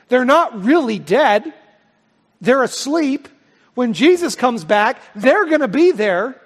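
high, loud, emphatic sermon voice; rousing pulpit proclamation; forceful preaching. Acted. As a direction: proclaim the hope with building force. Preaches hope at full force.

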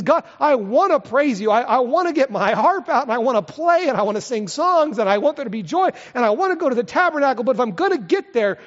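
loud, high pleading prayer; paraphrasing David's prayer. Acted. Preacher voicing David begging God to lead him back, loud and pleading. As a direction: beg God earnestly, full voice. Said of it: "He says".

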